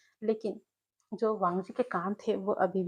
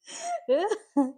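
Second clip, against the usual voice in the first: high, loud roaring laugh; loud laughter; 10 semitones higher, 4 dB louder than usual. Acted. Jiang Cheng laughs hard overhearing Wing's news, high roaring laugh. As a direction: laugh hard at a friend's misfortune. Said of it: "जोर-जोर से हंसने लगा था".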